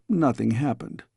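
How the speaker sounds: ordinary delivery, nothing marked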